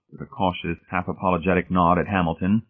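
plain reading voice

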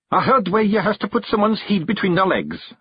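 high Scottish voice, mildly unsure; Rob Anybody, doubtfully. A narrator's reading. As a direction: offer a remedy you half believe. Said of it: "said Rob doubtfully".

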